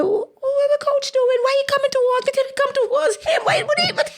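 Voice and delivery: very high, squeaky confused voice; a young athlete puzzled as the coach runs toward her; baffled inner voice. Retold or imitated. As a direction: pitch way up, fast, bewildered. Retelling her own confused thoughts.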